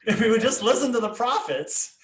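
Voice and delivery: real laughter breaking the line; laughing. Real, not acted. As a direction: laugh out the words in disbelief. Laughs at not listening to prophets.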